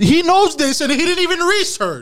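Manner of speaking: incredulous. Real, not acted. Incredulous outburst.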